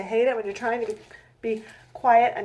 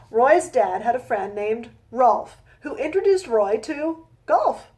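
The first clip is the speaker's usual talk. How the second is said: slow, rhythmic rhyming read-aloud; storybook reading; 5 dB louder than usual. A narrator's reading. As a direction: read each rhyme out slowly to a child. Reads her rhyming picture book.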